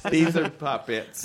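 slow Southern drawl; Southern accent. Acted. Voicing co-host's sister judging the puppets, Southern accent. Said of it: "It's a good Southern accent".

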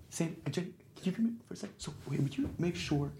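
John quietly summons crew members, whispered; whispering. Retold or imitated. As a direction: whisper it so the set won't hear. John calls crew over quietly.

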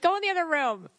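half-serious exasperated command; mock scolding. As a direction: playfully shoo the dog away. Acted banishing of the dog.

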